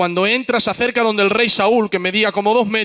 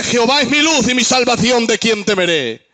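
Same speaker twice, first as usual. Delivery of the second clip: higher, declaimed psalm verses; psalm recital; 5 semitones higher, 4 dB louder than usual. Acted. Preacher reciting Psalm twenty-seven to the congregation, declaiming. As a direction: proclaim the psalm like a sermon.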